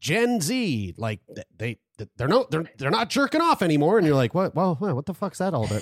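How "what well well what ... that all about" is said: baffled. Retold or imitated. Imitating a baffled reaction to headlines.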